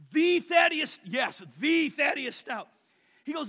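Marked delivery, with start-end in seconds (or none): 0.1-1.0 high excited shout of the name; excited recognition. Retold or imitated. Caller's excited recognition on phone. 1.0-2.7 louder, slow emphatic yes; emphatic confirmation. Retold or imitated. Confirming name on phone call.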